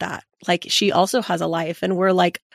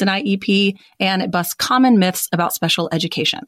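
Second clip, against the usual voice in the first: ad read. Acted. Host reads a podcast promo.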